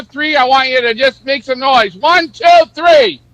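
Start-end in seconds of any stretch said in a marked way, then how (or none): 1.9-3.2 loud countdown. Real, not acted. Counting down for crowd noise.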